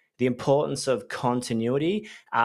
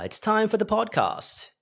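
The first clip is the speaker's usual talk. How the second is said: announcer voice; 9 semitones higher than usual. Acted. Podcast intro jingle voice.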